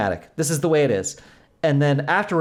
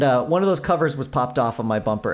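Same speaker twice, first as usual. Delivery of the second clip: ordinary delivery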